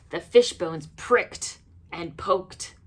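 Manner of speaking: plain reading voice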